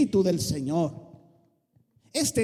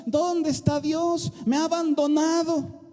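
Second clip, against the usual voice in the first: self-pitying complaint; 8 semitones higher than usual. Retold or imitated. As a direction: whine that God left you. Imitating a complaining believer.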